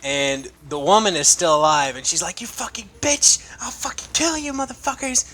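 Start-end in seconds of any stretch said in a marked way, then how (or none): 2.4-5.2 higher whispered mock woman's threat; hissed curse. Wounded woman cursing the captives in a high whisper.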